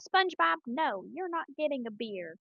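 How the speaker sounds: firm, drawn-out Texas refusal; high Texas twang on the name; Texas squirrel accent. Acted. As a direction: scold slowly in a Texas accent. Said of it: "best Texas squirrel accent".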